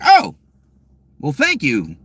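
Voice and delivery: surprised. Retold or imitated. Reacting to being chosen.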